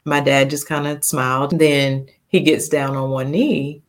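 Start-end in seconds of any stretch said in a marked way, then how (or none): none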